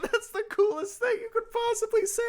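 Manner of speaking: very high squealing awe; over-the-top fangirl squeal; gushing fan. Retold or imitated. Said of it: "And I'm like".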